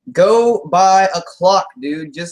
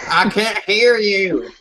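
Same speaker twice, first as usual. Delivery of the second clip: mock holler, drawn out and higher; Keith; 4 semitones higher, slower than usual. Co-host playing the tribe mate who can't hear, mock holler. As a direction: stretch it out as an imitated complaint. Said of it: "he's screaming about how he can't hear".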